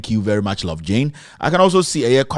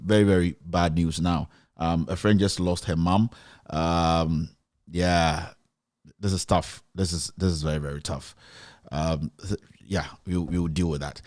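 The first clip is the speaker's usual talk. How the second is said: softer, heavy-hearted and faltering; hushed, halting sympathy for a grieving friend; sombre condolence; 5 semitones lower, 6 dB quieter than usual. Real, not acted. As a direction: let real sorrow pull the voice down and quiet.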